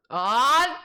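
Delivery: loud, rising "Ah" of scandal; mock outraged gasp. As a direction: cry out as if betrayed. Mock outrage at a raised hand.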